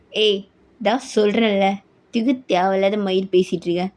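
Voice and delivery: low, gruff-tempered rebuke; irritated husband. Acted. Husband rebuking wife's questions, irritated.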